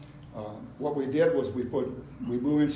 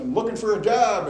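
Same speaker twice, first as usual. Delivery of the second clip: loud, raised-pitch answer back; hollered reply; 7 semitones higher, 6 dB louder than usual. Retold or imitated. Cocky job seeker answers a yelling boss, hollering back. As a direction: call it back boldly.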